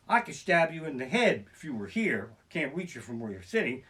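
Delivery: ordinary delivery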